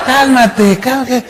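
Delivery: calm me down through a laugh; chuckling while soothing. Retold or imitated. Quoting the Lord, laughing. (riendo al calmar)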